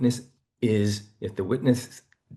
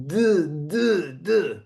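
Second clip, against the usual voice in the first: stuttering duh sounds; 8 semitones higher, much slower than usual. Shown as a demonstration. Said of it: "There's an energy that's trying to get out".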